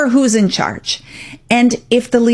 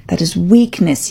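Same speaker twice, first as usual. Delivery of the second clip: ordinary talk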